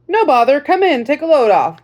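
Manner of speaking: lightly voiced grandfatherly veteran; old sergeant voice. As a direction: greet the girl like a folksy old sergeant. Sergeant invites girl in.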